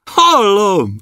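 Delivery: high, glad little cry; happy shout. Acted. Boy answering the dwarfs, high and glad. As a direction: answer joyfully, voice jumping up. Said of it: "kiabálta Andris boldogan".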